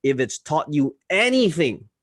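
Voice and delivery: rising, punchy read with ANYTHING stressed; good tonality. Shown as a demonstration. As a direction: lift the line and lean into "anything". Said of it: "now that is good use of tonality".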